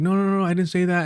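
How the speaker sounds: imitating a panicked coworker denying it; panicked denial. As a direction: imitate her flustered denial. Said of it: "like, in a panic".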